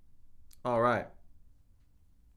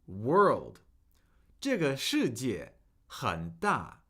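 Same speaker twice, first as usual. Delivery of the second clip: ordinary delivery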